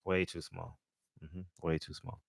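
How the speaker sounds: usual speaking voice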